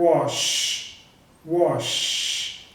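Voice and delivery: "wash" with a drawn-out SH; voiceless final SH. Shown as a demonstration. Teacher introducing the verb, "wash" with long voiceless SH. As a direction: hold the unvoiced SH at the end of "wash". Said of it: "tem vibração também".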